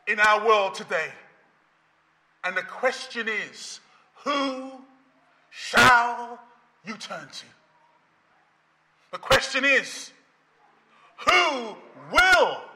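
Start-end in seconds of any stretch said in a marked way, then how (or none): none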